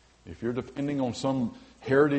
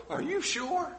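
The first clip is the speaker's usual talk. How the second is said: doubting listener; slower than usual. Acted. Mock doubter questioning the pastor.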